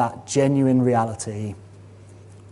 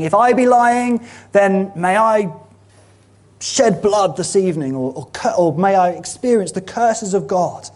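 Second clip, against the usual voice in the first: higher, louder desperate self-curse; Peter cursing himself; 7 semitones higher, 5 dB louder than usual. Acted. Peter cursing himself to the crowd, desperate and higher. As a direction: call down a curse on yourself, pleading. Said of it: "Peter curses himself".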